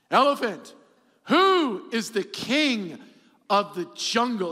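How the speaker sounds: loud, drawn-out challenge; slow proud lion. Acted. Lion puffing his chest out at the elephant, slow and loud. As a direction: stretch the question out with puffed chest.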